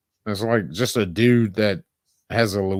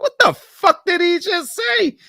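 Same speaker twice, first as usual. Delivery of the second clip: baffled disbelief; 4 dB louder than usual. Retold or imitated. Baffled by the heavy accent.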